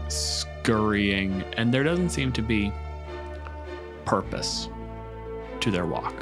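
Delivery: ordinary talk, nothing marked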